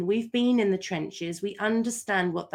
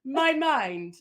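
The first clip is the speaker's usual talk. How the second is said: very slow, higher mock plea; mid-labor plea; 6 semitones higher, slower than usual. Mother in childbirth, slow mock plea. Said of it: "you're like".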